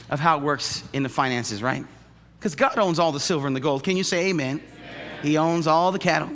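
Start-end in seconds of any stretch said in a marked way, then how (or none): none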